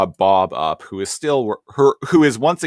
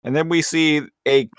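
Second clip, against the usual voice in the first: ordinary talk, nothing marked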